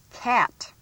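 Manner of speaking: usual speaking voice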